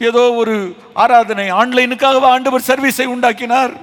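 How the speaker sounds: forceful preacher addressing the flock; impassioned preaching. Acted. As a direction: press the questions on the congregation with force. Preaches challenging questions.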